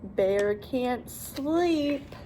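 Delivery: ordinary delivery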